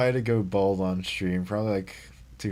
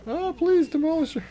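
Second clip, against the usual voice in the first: high, squeaky pleading voice; a hammed-up plea for mercy; mock begging; 3 dB louder than usual. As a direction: beg for mercy in a tiny high voice. Begging the enemy card in-game.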